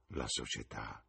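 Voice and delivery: borbottato, più basso e molto più piano; mormorio. Acted. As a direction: mormoralo tra sé con disprezzo. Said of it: "mormorò il ragazzo". (il ragazzo mormora cupo, molto sommesso)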